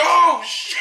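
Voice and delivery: high, loud retold shout of alarm; startled mock yell. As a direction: yell it like a panicked movie character. Retells actor's startled yell in pool.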